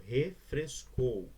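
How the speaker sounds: "refrescou" very slowly, in separate syllables. Shown as a demonstration. Teacher showing F and R stay together, syllable by syllable. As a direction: break "refrescou" into re-fres-cou. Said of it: "Eu não separo".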